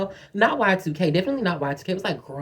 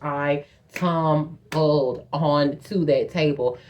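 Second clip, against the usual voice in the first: "tumbled" stretched out syllable by syllable; stress on tumbled; 3 semitones lower, much slower than usual. Real, not acted. Telling friends about the fall, "tumbled" slowly broken up. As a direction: draw out "tumbled" very slowly for dramatic emphasis. Repeats her fall for emphasis.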